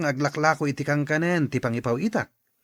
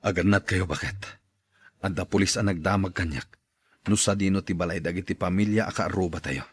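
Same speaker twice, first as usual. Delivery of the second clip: much lower, subdued put-on voice; low worried man; 12 semitones lower, 4 dB quieter than usual. Man telling his wife police questioned him, low and worried. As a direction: speak low and uneasy about the police.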